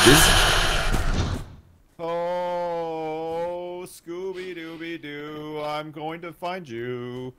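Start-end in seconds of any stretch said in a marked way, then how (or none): 2.0-7.3 slow, soft sing-song menace; slow stalking taunt. Acted. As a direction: creep up on them with a lullaby threat.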